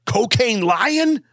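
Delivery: dramatic trailer announcer voice, high and slow; movie title. Pretend.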